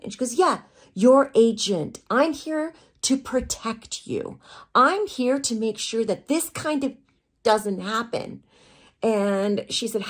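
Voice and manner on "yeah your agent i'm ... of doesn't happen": high, loud, slow reprimand; emphatic scolding. Retold or imitated. Agent telling model she is there to protect her, emphatic scolding. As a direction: drive each phrase home slowly.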